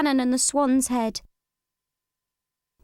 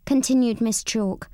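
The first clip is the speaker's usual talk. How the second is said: ordinary delivery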